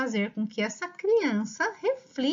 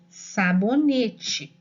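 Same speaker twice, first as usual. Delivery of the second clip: "sabonete" drawn out very slowly and a little louder, said whole; slow repeated dictation; 4 dB louder, much slower than usual. Shown as a demonstration. Teacher repeating the dictated word to a child, slow and clear. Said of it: "Aí você repete".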